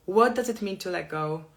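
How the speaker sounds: ordinary talk, nothing marked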